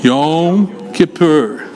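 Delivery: Yom Kippur slowly, long O in Yom and long U in Kippur; Hebrew Yom Kippur. Shown as a demonstration. Teacher drilling the class on Hebrew Yom Kippur, slowly. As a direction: sound out Yom Kippur syllable by syllable, slow. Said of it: "If I say it".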